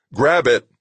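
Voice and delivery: loud, insistent rescuer; urgent command. A narrator's reading. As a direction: order it with life-or-death urgency. Rescuer urging man on cliff edge.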